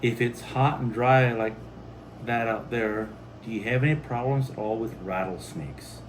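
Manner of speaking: ordinary talk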